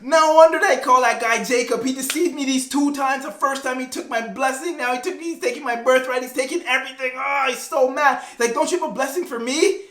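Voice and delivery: high-pitched hammed-up ranting; super mad. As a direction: act out a cheated brother's outraged tirade. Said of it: "obviously Esau's super mad".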